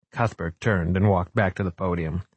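usual speaking voice

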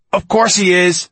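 loud, high irritated retort; snapping. A narrator's reading. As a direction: snap back impatiently at the guard. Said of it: "snapped Fenoglio".

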